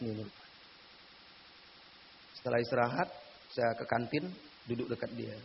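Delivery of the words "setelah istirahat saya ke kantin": normal talking voice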